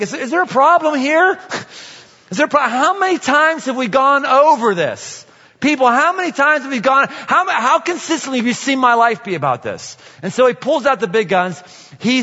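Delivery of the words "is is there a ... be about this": exasperated Jesus. Retold or imitated. Jesus scolds the muttering Pharisees, exasperated and raised. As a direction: scold them like a weary teacher. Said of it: "He's like".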